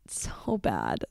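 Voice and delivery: soft whisper to oneself; under the breath, barely voiced; whispered dismay. Real, not acted. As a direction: drop to a hushed, ashamed whisper. Muttered self-judgment after the story.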